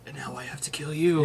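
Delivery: breathy hushed mafia threat; hammed-up mobster whisper; whispered mock mobster threat. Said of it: "It just sounds like your normal voice".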